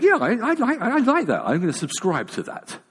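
high, loud burst of mock eagerness; giddy, laughing inner voice; sudden enthusiasm. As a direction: act out being instantly sold. Acting out his sudden enthusiasm.

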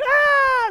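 short high mock scream; high yelp of fright. Grown men screaming at a ghost.

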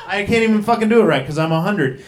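ordinary talk, nothing marked

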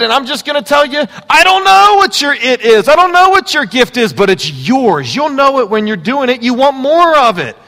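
loud, high, driving sermon voice; full-force preaching. Acted. Pastor urging the congregation to find their gift, preaching loudly. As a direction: preach it out with rising fire.

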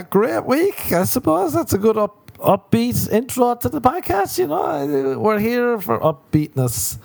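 high-pitched mock-upbeat intro; sarcastic cheer. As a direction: squeak out forced podcast cheer, sarcastically. Mock-cheerful podcast intro after a rant.

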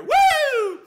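very high, loud imitated siren; siren whoop. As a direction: mimic a police siren.

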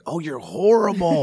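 high, drawn-out "Oh, you're horrible"; mock disgust. Comedian friend judging his old tape, mock disgust. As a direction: pretend to recoil from a bad set.

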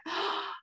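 high, quick gasp of delight. Real, not acted. Host seeing a listener's pet pictures, delighted gasp. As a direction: gasp at something adorable.

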